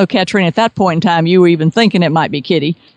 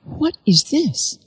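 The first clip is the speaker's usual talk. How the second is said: soft, slow and high, puzzled; quietly taken aback, drawing it out; a hushed, wondering question; puzzled surprise; 8 semitones higher, 7 dB quieter, much slower than usual. Retold or imitated. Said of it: "And I'm going".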